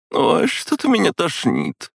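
high, slow queasy woman; nauseous drunk. A narrator's reading. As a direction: moan it, about to be sick. Drunk woman feeling sick.

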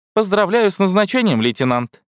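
high-pitched chirp, dropping on the last word; squeaky paperclip. A narrator's reading. Talking paperclip congratulates the new lieutenant, squeaky. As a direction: squeak it out like a little talking gadget. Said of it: "пропищал говорящая скрепка".

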